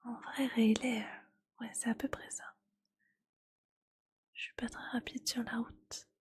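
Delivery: soft-spoken ASMR voice, gently voiced after the whisper. ASMR vlogger switching to soft spoken for viewers, gentle and calm. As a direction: speak softly and calmly, close to the mic. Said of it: "je commence le soft spoken".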